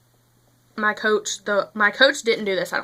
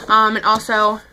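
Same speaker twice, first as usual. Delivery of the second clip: ordinary delivery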